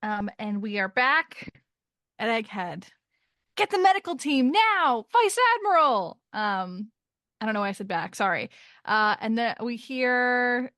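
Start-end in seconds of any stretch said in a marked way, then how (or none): 3.5-6.2 higher and louder, calling out an urgent order; urgent yell. Acted. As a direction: bark it across the deck for a medic. Reading a vice admiral's yell.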